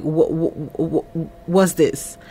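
low, soft stuttered question; retold stammer. Man thrown by what he is shown, mock stammering. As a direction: stumble repeatedly on the first word, quietly.

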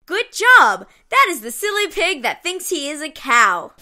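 ordinary delivery

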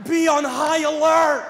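loud, slow warning bark; hammed-up government alert; mock alarm call. Comic mocking officials, loud slow alarm.

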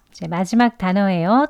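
ordinary talk, nothing marked

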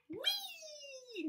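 high soft squeal of joy; Frog cries wee. Acted. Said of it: "And Frog cried".

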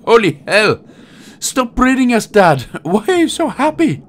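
put-on high, odd baby voice; high silly voice. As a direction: whine at the dad, high. Babies scolding dad.

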